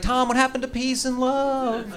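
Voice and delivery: high, slow mocking wife voice. Comic mimicking his wife's reminder, high and slow. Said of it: "She's like".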